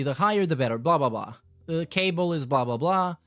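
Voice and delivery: normal talking voice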